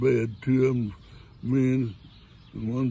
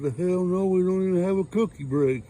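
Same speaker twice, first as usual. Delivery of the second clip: put-on higher, louder little boy protest; boy in the back answering the teacher; indignant kid; 7 semitones higher, 4 dB louder than usual. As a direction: jump up and protest like a small boy.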